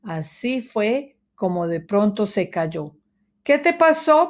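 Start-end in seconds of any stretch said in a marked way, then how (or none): 3.4-4.3 high, loud alarmed question; startled mouse. A narrator's reading. Mouse alarmed when the dog falls, high and loud. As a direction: cry out in alarm. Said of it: "preguntó Ratón Ramón".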